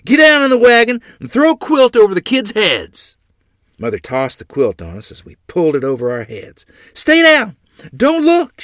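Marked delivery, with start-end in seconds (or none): 0.1-3.2 shouted order, much higher and louder; urgent yell. A narrator's reading. Father shouting orders to mother in the wagon. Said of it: "Father yelled". 6.9-8.6 frantic order, much higher and louder; urgent shout. A narrator's reading. Mother shouting at children under the quilt.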